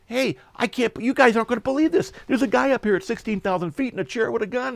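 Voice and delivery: high-pitched, incredulous pilot's report; astonished pilot. Retold or imitated. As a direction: call it in with amazed disbelief. Pilot radioing tower in disbelief.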